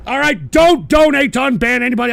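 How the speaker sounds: loud, high, insistent order; angry warning. Real, not acted. Streamer forbidding donations for unbans, insistent angry shout. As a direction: hammer it home at volume. Host angrily repeats warning.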